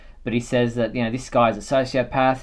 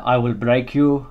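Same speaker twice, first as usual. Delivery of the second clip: put-on quoting Drago in a Russian accent; menacing Russian boxer line. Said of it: "in the Russian accent there".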